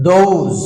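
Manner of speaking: "দোজ" ending in a z sound; voiced final S. Shown as a demonstration. Teacher showing how those ends, voiced final S. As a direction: say "দোজ" as doze. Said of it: "এস বর্গীয় জ".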